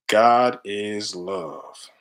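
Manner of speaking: each word of "God is love" drawn out long and slow; read out very slowly. Shown as a demonstration. Reading his chest tattoo aloud to viewers, very slow. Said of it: "It's before I was on my spiritual journey".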